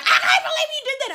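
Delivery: very high, loud squawk; outraged mock shriek. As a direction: imitate an indignant woman squealing. Acts out cellmate's outraged shriek.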